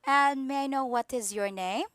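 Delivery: ordinary delivery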